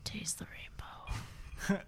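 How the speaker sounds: slow, unvoiced mock-slogan whisper. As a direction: breathe it out slowly like a secret ad line. Joking slogan after mouth banter.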